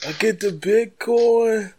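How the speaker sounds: high, slow drawled rapper voice. Acted. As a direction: stretch every word out slow and high.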